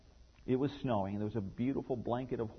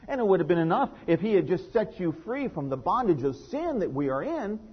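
higher, louder preacher's build; impassioned preaching; 5 semitones higher, 6 dB louder than usual. Real, not acted. Pastor stirring the congregation, preaching at full force. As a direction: preach it, climbing to the peak.

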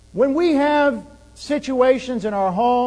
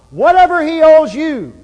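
louder and higher, pleading on his behalf; Jesus pleading aloud; 7 semitones higher, 9 dB louder than usual. Acted. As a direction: call out the offer to the Father. Jesus voiced pleading to Father.

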